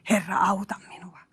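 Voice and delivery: hushed whisper of a desperate prayer; a plea barely breathed. Acted. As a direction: whisper the cry for help. Sinking man's plea voiced.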